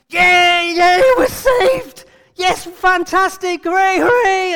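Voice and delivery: high, loud, shouted cheering; mock cheering. Disciples celebrating being saved, hammed-up cheering. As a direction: whoop like a rescued crowd. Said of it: "and cheer".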